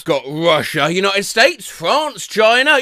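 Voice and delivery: ordinary talk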